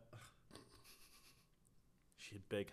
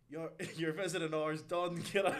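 loud, higher mock-grumpy dismissal; imitating granddad; 6 semitones higher, 9 dB louder than usual. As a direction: shoo the visitors out like a blunt grandfather. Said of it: "older people maybe visiting my granddad".

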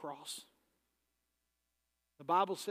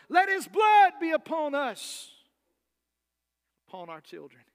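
shouting crowd cry, loud and higher, then dropping away; jeering crowd; 8 semitones higher, 15 dB louder than usual. Retold or imitated. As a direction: hurl it out like the jeering mob, then let it sink. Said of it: "as they jeered and they sneered".

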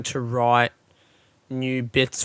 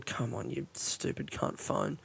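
muttered aside, much softer; muttering at phone; 5 semitones lower, 17 dB quieter, slower than usual. Real, not acted. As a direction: grumble it under your breath at the phone. Cursing his phone under breath.

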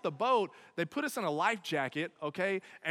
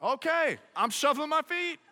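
nervous compliance; 9 semitones higher, 4 dB louder than usual. Retold or imitated.

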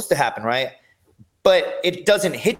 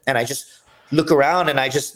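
ordinary delivery

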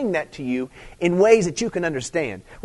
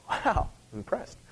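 ordinary talk, nothing marked

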